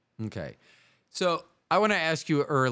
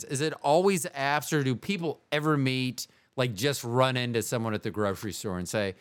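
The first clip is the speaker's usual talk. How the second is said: ordinary talk, nothing marked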